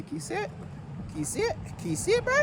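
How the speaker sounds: rising excited question; eager, pushing pitch up high. Real, not acted. Showing lucky number on screen.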